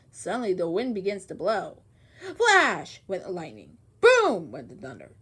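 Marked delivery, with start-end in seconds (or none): 2.4-3.8 punchy, louder storm call; booming storytime flash; dramatic storm narration. A narrator's reading. Dramatic storm sound words. 4.0-5.1 lower, louder thunder boom; big storytime boom. A narrator's reading. Dramatic storm sound words.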